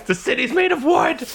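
high, laughing mock outcry; mock alarm. Game master objects to starting a fire, in mock alarm. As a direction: squeal it in pretend alarm.

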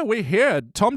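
normal talking voice

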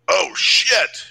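high startled yell; startled yelp. Retold or imitated. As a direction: jump at a snake, yelling. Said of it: "you made it sound like it was".

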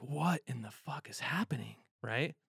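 whispered disbelief; whispered, staring at a stopped freeway. Retold or imitated. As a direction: breathe it out in a stunned whisper. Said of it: "And I was like".

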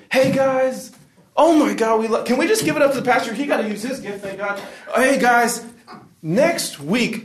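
very high-pitched mock gushing; relentlessly peppy host parody; church emcee. Emcee greeting church after the sermon, hammed-up gushing. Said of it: "the M C comes up afterwards".

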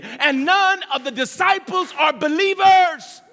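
rising, slow, forceful sermon voice; fiery preaching. Acted. Preacher stirring up the church, full force. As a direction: build the fire, let it swell.

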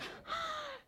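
sharp high gasp of shock. Real, not acted. Preacher reacting to Elijah's boldness with a gasp. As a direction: suck in a startled breath. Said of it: "And I'm like".

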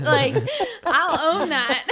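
giggly, unashamed admission; laughing boast. Real, not acted. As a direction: crack up while proudly claiming it. Joking boast amid shared laughter.

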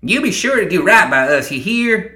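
high-pitched, loud admonishment; Ma Tucket, bossy. A narrator's reading. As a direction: warn him in a high voice.

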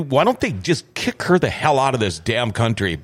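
usual speaking voice

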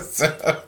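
high breathy burst of laughter; amused laugh. Real, not acted. Pastor laughing at his own comeback, real laughter. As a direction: laugh at your own punchline.

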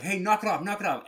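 sharp yell to stop the dogs. Retold or imitated. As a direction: shout it to break up a fight. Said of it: "the girl was like".

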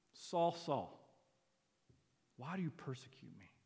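tender appeal, falling to soft; intimate closeness. Shown as a demonstration. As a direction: say it gently, as to someone beloved. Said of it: "this is an expression of closeness".

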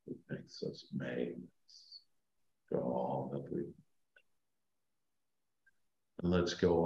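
slow, soft meditation voice; sleep story narrator. Acted. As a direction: guide them down gently, fading to a whisper. Leads a guided meditation.

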